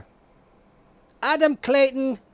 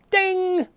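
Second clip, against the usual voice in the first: high sung ding; mock game show ding; ding chime; 7 semitones higher than usual. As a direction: imitate the correct-answer bell.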